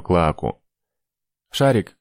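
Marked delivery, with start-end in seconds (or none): 1.5-2.0 calling out. A narrator's reading. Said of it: "позвал Виштальский".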